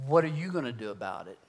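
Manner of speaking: quiet, even question from God. Retold or imitated. God answering an angry man's prayer, quiet and soft. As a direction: put it softly, as an inner prompting. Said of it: "as audible as God speaking himself".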